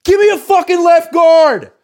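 very loud high slow shout; coach yelling. Retold or imitated. Reporter imitating Harbaugh benching a player, shouting. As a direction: bellow for a new left guard. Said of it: "He'd say something like".

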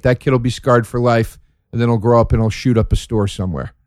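ordinary talk

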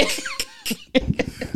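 shrieking laughter; high-pitched laugh. Real, not acted. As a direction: crack up in a high squeal. Laughing at funny story.

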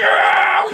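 breathless delighted gasp. Retold or imitated. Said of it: "She's like".

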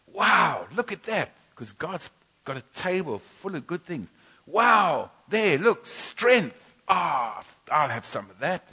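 delighted wonder. Retold or imitated. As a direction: gasp with joy at each dish. Delighting at God's table.

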